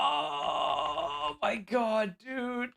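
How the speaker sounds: soft, high, drawn-out groan; pained dismay. Real, not acted. Analyst lamenting the missed ultimate, pained dismay. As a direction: let a long, quiet wail sag out.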